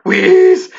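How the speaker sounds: a high, loud wheeze gasping for air. A narrator's reading. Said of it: "gasping for breath".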